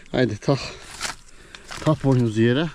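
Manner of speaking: ordinary talk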